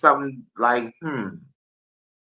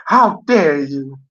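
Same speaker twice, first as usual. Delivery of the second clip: slow, higher, indignant how dare you; mock outrage; 5 semitones higher, 4 dB louder, slower than usual. Streamer scolding the BBC over Doctor Who, slow mock outrage. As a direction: drag it out like a scandalised accuser.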